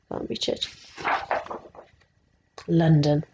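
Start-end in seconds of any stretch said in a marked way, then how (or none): none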